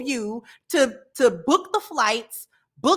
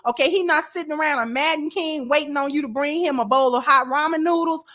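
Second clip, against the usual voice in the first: normal talking voice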